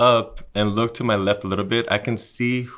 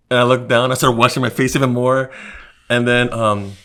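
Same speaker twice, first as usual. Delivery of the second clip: ordinary talk, nothing marked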